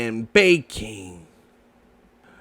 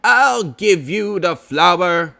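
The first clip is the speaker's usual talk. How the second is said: a lightly voiced fussy Rabbit; 2 semitones higher, 4 dB louder than usual. Rabbit tells Pooh about flour, light character voice. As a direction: Rabbit offering flour, slightly put on.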